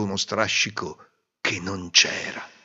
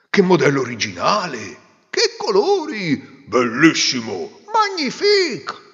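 high, louder, stretched-out exclamations; gushing praise; 6 semitones higher, 6 dB louder than usual. Acted. Crowd flattering the emperor, high exaggerated praise. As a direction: overdo the admiration. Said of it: "tutti dicevano".

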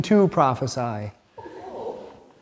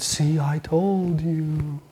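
smug oracle; 4 dB quieter, much slower than usual. Retold or imitated. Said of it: "And she said".

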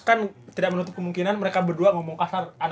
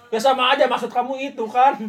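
higher, louder mock reproach; lightly voiced scolding mother; 5 semitones higher, 5 dB louder than usual. Mother rejects child's excuse, mock scolding. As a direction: challenge the excuse like a parent.

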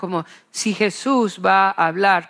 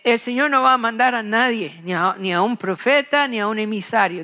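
ordinary delivery

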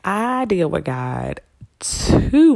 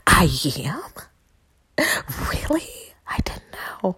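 stunned whispered disbelief; surprised whisper; 3 semitones higher, 4 dB quieter than usual. Retold or imitated. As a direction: whisper it in shock. Surprised reply to God.

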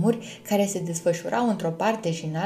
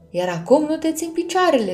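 pitched-up, emphatic observation; pointed remark; 8 semitones higher, 4 dB louder than usual. A narrator's reading. Firion points out Luna's stumbling to help, emphatic. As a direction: point it out helpfully, voice rising high. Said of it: "sublinie Firion".

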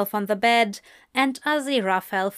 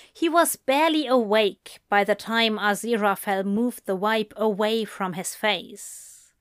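ordinary delivery, nothing marked

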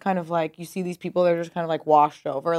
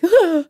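panicked gasp; 12 semitones higher, 9 dB louder than usual. Retold or imitated.

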